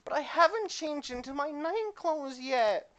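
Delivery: put-on high, slow girly voice; clumsy girl voice. GM voicing the clumsy girl at bedtime, high and slow. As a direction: play her high and dainty, taking her time.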